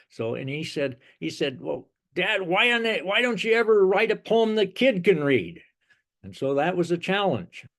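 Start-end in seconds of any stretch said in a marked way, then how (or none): 1.6-5.6 put-on high, protesting little-boy voice; six-year-old son. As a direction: imitate a child challenging his dad, pitched well up. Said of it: "six years old at the time".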